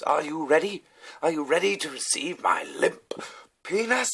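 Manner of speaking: high, loud, hammed-up challenge; imitated arrested diner. Diner pushed into police car taunting officers, mock grand challenge. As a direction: declaim it theatrically, pitching the last word highest. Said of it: "and he goes".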